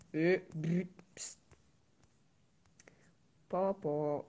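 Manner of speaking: whispered muttering under the breath. Real, not acted. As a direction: whisper it to yourself.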